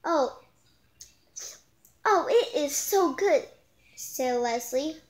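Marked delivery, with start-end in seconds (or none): 0.0-3.5 high, loud delighted gush; delighted exclamation. Acted. Dee Dee gushing to the class about the ice cream. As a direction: gush over the taste.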